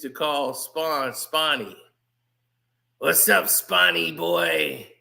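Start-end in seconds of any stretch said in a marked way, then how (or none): 3.0-4.9 lower, slower villain taunt; a hint of Violator's mocking drawl. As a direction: drag it out like a sneering demon clown. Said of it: "in that accent of Violator".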